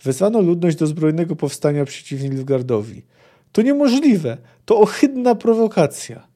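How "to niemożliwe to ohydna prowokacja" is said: high, loud cry of outrage; a sorceress screaming at a betrayal; outraged shriek. Acted. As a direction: shriek it out in disbelief. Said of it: "twoje wrzaski nie przerwą transu".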